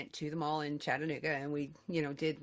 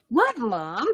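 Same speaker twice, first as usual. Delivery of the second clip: mock innocence; 3 semitones higher, 7 dB louder than usual. Drunk mock-innocent reply retold.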